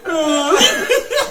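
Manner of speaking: a drawn-out "uh", then "legal." squeaked very high and loud; mocking the umpire. Throwing the ump's own call back at him, high loud mocking imitation. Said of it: "I said".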